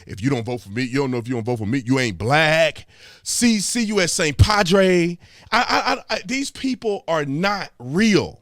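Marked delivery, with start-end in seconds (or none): 0.1-5.2 mock pandering politician. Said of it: "They got hot sauce in their purse".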